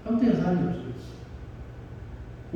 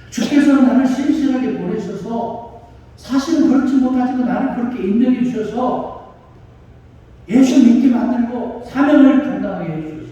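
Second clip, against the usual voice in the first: raised, higher declaiming sermon voice; impassioned preaching; 6 semitones higher, 5 dB louder than usual. Acted. Preacher voices Paul's testimony to the congregation, impassioned preaching. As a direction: proclaim the testimony with full force.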